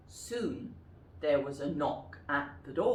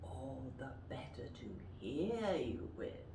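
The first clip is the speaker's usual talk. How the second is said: quiet wolf; 5 semitones lower, 9 dB quieter than usual. Shown as a demonstration. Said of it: "Can you say that?".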